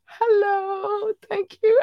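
high, squealed hello and thanks; drawn-out falsetto gushing; cutesy greeting. Pretend. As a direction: coo it in a squeaky voice. Squealed greeting to Chinese listeners.

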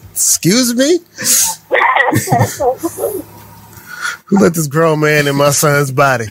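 mock shocked, laughing through it; laughing outrage. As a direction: play the shocked mother while cracking up.

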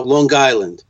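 "Long Island" with a hard G, as "Lawn Guyland"; New York-accented "Long Island"; thick New York accent. Shown as a demonstration. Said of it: "thick New York accent".

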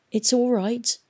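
strange, thin voice. A narrator's reading. Girl steps forward to face the stalker, thin voice. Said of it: "in a strange, thin voice".

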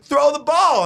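high, loud mock heckler shout; heckler's yell. Comedian imitating the fan repeating his yell when confronted. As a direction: bark it like the impatient fan. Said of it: "He's like".

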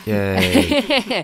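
high, loud little cheer; a squeaky burst of joy for the host. Real, not acted. Co-host cheering the podcast's welcome back, high and loud.

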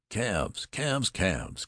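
high, eager shouts of the word; excited cries. A narrator's reading. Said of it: "cried Jones".

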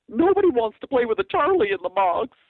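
loud, high-pitched snide comic voice; Paul Lynde impression. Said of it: "try to talk like Paul Lynde".

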